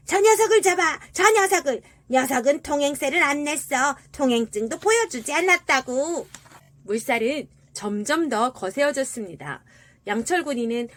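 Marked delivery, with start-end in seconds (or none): 0.1-6.3 sewer rat shouting. A narrator's reading. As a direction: yell the alarm as an angry rat. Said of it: "나무 조각들에게 소리쳤습니다". (시궁쥐가 높은 소리로 고함치듯)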